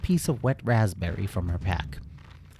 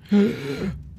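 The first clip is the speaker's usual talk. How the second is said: high breathy gasp for air; a fish flapping on land, gasping; 10 semitones higher than usual. Acted. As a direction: gulp air like a stranded fish. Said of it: "It gasped in the air".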